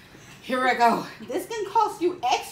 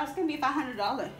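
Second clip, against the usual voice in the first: usual speaking voice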